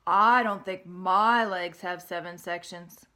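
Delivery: slow, loud astonished pig; amazed pig. Acted. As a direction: drag it out in disbelief. Wilbur doubtful.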